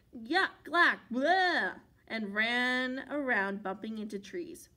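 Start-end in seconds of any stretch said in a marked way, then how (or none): 0.1-1.8 loud gagging yell; Megan yelling yuck. A narrator's reading. Megan sprayed in the face, loud gagging yell. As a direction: gag on the bug spray. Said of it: "Megan yelled".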